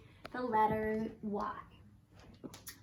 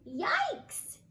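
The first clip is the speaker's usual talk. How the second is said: a high, sharp yelp of surprise; startled yikes; 8 semitones higher than usual. Acted. Old yak hit by a yam, startled yelp. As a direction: yelp like you just got hit on the head. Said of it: "yelled the wise old yak".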